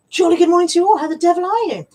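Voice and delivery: high, loud hearty "jolly good morning"; jolly posh greeting. Pretend. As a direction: play a hearty old English gent greeting everyone.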